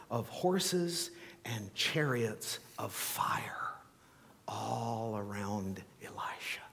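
slow whisper of wonder; preacher hushing the congregation for the miracle, slow whisper; hushed awe. Acted. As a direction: let it drop to an awed whisper. Reverent climax of the story.

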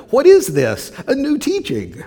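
loud, high-pitched astonished questioning; acted amazement. Amazed crowd asking each other about Jesus, loud high astonishment. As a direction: exclaim it in amazement, pitch soaring. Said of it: "The people were all so amazed".

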